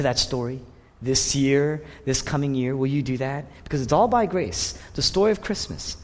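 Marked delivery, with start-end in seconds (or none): none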